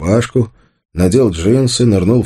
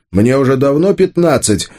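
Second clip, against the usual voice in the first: raised, sharp correction; sharp retort; 8 semitones higher than usual. A narrator's reading. Arsen corrects Anya about his age, sharply. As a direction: cut in sharply on a higher pitch. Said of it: "резко сказал Арсен".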